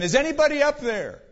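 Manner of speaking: high, loud acted call for help; hammed-up cry into the distance; crying out to heaven. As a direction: holler upward as a desperate man in a joke. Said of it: "he cried out to heaven".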